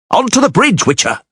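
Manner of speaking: high shouted command; urgent shout. A narrator's reading. Doragore urging the Witcher onto the bridge, high shout. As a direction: call them across, pitched high.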